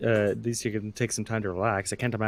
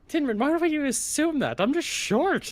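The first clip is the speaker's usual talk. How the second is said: much higher, squeaky and flustered; panicky high squeak; 14 semitones higher than usual. Acted. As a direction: squeak out the surprise like a startled little fae.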